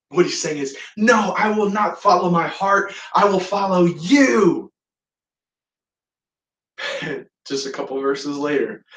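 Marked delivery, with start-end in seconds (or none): 0.9-4.7 higher, emphatic acted declaration; insistent vow. Peter restating his promise to follow Jesus, insistent. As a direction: declare it firmly, stretching the last words. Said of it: "Peter insisted".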